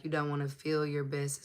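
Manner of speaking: ordinary talk, nothing marked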